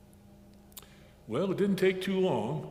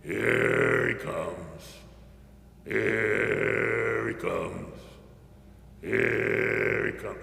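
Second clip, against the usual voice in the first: deep drawn-out frog croak; long, low monotone frog call; bullfrog calling; 8 semitones lower, much slower than usual. A narrator's reading. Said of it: "bullfrog calling out as he got closer".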